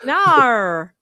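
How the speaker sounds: loud drawn-out mock Aussie; badly faked Australian vowel; Australian accent. Host joining the accent contest, mock Aussie accent. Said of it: "worst Australian accents".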